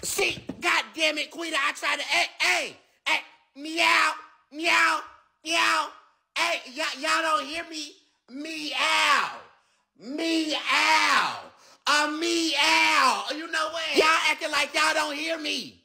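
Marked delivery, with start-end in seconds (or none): 3.6-5.9 mock meow. Alien meowing to get attention. 8.3-13.2 yelled cat calls; shouted meow. Acted. Calling out meow loudly.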